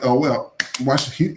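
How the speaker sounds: ordinary delivery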